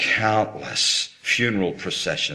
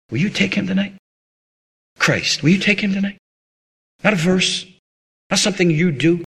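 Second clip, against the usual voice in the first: higher, earnest appeal; altar call plea; 8 semitones higher than usual. Acted. Preacher inviting listeners to receive Christ, pleading.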